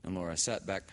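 ordinary delivery

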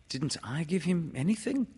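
put-on wistful little child voice; sad. A narrator's reading. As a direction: ask it plaintively, pitched high.

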